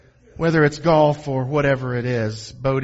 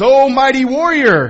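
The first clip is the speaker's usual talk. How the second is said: high, loud heroic hail; grand, hammed-up divine greeting; booming mock proclamation; 11 semitones higher, 11 dB louder than usual. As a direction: hail the warrior like God from on high. Voices God hailing hiding Gideon.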